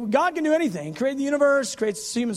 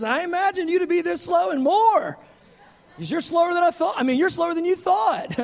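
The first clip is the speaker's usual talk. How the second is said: cheerful reassurance; 8 semitones higher, 5 dB louder than usual. Retold or imitated. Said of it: "He goes".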